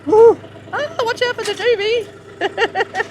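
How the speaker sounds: high, loud whoop of delight; excited cheer. Real, not acted. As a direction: cheer it out, thrilled. Cheers as the tractor lifts.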